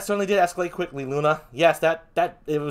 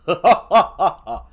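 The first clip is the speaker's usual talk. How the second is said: laugh; 7 dB louder than usual. Real, not acted. Laugh after own joke.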